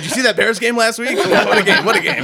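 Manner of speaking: high, loud mock-manly bluster; flustered sports talk. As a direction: cover embarrassment with loud game talk. Flustered men covering with football talk.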